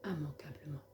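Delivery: solemn, measured poem reading with long pauses; poem recitation. Acted. Woman reading her own poem for the Lord, reciting solemnly. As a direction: recite it line by line, letting each phrase settle.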